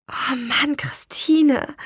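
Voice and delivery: high, breath-filled cry of dismay; dismayed outburst. A narrator's reading. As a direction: blurt it out, stricken for your sister. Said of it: "stieß ich aus".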